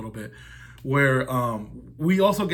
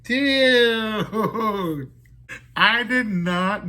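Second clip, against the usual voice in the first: laughing; 7 semitones higher, 5 dB louder, much slower than usual. Real, not acted. Laughing at a gross movie line.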